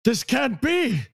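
rising high, disbelieving villain cry; villain panicking at his defeat. Pretend. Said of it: "the classic".

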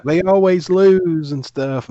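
slow, whiny mock complaint. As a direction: drag it out like a sulky fan. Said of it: "People are like".

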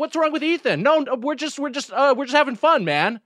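high-pitched imitation of flustered bullies; thrown-off bullies. Bullies backing down to Ethan, thrown off. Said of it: "They were so thrown off".

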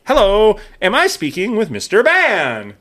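bright, slow salesy phone greeting; a hint of a cheesy telemarketer; mock telemarketer. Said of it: "it's like".

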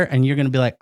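usual speaking voice

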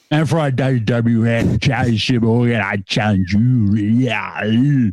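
a lightly voiced giant wrestler growling a challenge; gruff giant impression. Host mimicking Andre the Giant for his co-host, a light gruff impression.

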